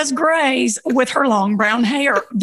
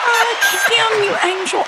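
gushing hello; 12 semitones higher, slower than usual. Real, not acted. Guest greets host joyfully.

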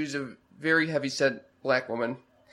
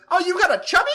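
much higher, louder put-on voice of the masseuse, teasing; imitating the masseuse; 8 dB louder, faster than usual. Retelling how the masseuse teased him about his erection, in a high, loud mock voice. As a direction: squeal it out like the amused masseuse. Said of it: "and she goes".

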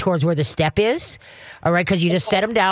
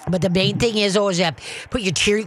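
ordinary delivery

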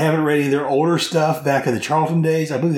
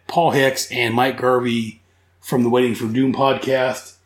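ordinary delivery

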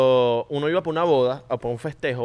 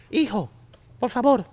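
higher imitation of mom; 9 semitones higher than usual. Shown as a demonstration. Mother warning her son about strangers, higher mom voice. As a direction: warn the kid like a worried mom. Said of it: "esa voz de mamá".

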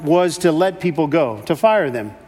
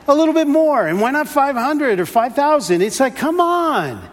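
pitched up, half-joking grumble at God; mock indignant complaint; 7 semitones higher than usual. Preacher mocking his own complaint to God, higher. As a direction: act out the petty gripe, voice raised high.

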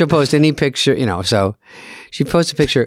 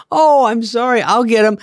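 imitating mom; 10 semitones higher than usual. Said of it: "And she's like".

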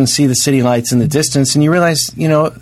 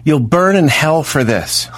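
mock yelling at parking kids; 6 semitones higher, slower than usual. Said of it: "rolled down the window and go".

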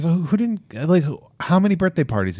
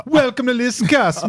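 much higher, excited silly voice; goofy greeting; 13 semitones higher than usual. Pretend. Cohost repeating greeting in high goofy voice. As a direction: belt the greeting like a cartoon host.

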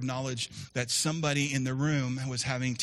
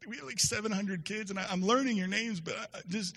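flustered excuse; 9 semitones higher than usual. Retold or imitated. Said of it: "and I was like".

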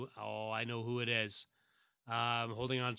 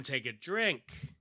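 normal talking voice

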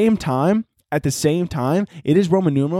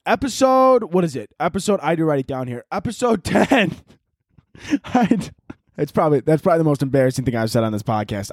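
ordinary delivery

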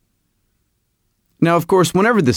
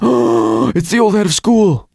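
sharp gasp, then higher-pitched astonished exclamation; acted-out startled realization; gasp of recognition; 6 semitones higher than usual. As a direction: gasp and blurt it out as if recognizing someone. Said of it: "The first time I saw Sarah Palin, I went".

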